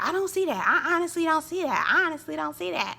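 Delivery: mock Kiki denial. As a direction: deny it stubbornly. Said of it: "Kiki's like".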